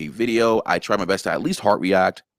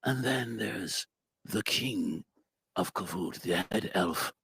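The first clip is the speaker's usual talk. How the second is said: very soft, measured elf king; king of Kavut; 8 dB quieter than usual. Shown as a demonstration. Actor demonstrates the head elf, hushed Alucard-inspired voice. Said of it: "his voice is a little inspired by, um, by, uh, Castlevania Alucard".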